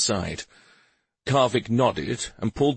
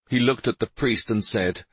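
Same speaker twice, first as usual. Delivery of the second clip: ordinary delivery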